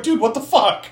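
high-pitched mock disbelief; baffled monster. Monster to Henry as the skylight closes, high mock disbelief. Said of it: "monster looks at Henry like".

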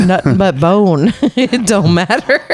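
giggling through the name; laughing repeat. Real, not acted. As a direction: say it while laughing.

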